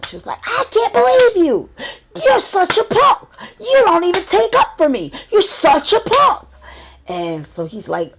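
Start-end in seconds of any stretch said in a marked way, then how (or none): 0.4-6.5 high, loud shrieking insult; furious friend. Retold or imitated. Friend screaming at husband he's a punk, high shriek. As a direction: shriek at him in drunk fury.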